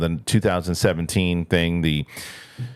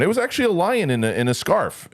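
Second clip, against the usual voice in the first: high, fast hammed-up claim; mock official; 9 semitones higher than usual. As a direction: fake a straight-faced absurd excuse. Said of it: "with a lion going".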